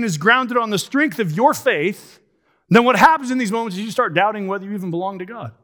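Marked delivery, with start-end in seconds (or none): 2.7-5.5 rushed through, falling at the end; quick plain answer. Real, not acted. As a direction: say "what happens in these moments" fast and matter-of-fact.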